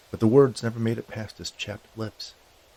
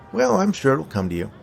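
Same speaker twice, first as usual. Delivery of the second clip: higher-pitched character voice; encouraging skeleton; 6 semitones higher, 3 dB louder than usual. A narrator's reading. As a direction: lift the pitch, brightly brushing it off.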